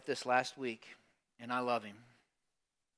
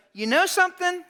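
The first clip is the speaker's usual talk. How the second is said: exasperated Martha; 10 semitones higher, 13 dB louder than usual. Retold or imitated. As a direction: lay the blame on him, exasperated. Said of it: "and she's like".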